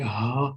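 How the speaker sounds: ہا pushed out low from the chest; hard ha. Shown as a demonstration. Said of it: "جیسے کہ اس کی آواز سینے کے اوپر سے نکل رہی ہوتی ہے". (ہا سخت اور نیچی آواز میں، سینے سے)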